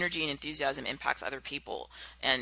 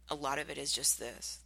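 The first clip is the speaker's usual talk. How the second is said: normal talking voice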